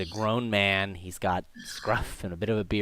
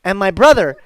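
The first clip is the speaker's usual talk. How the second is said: put-on high, loud little-boy voice; proud young boy; 9 semitones higher, 12 dB louder than usual. Boy telling stranger about his namesake, proud and young. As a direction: show off like a proud kid.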